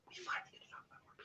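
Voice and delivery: whispered repeat of the line. Shown as a demonstration. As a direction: breathe it out without voice. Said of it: "So we finally get to talk about more people".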